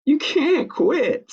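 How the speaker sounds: put-on high, slow little girl protest; Ava protesting. Sleepless girl pleading with quitting sheep, high and slow. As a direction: draw out the child's dismay. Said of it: "said Ava".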